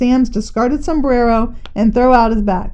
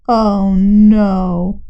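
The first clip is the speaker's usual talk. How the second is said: dismayed groan; 3 semitones lower than usual. Acted. Butcher dismayed at ambulance.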